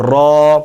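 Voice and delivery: را with rounded lips, full and heavy; a thick rounded را; heavy letter demo. Shown as a demonstration. Teacher models a heavy را. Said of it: "ایک الف کے برابر کھینچنا ہے".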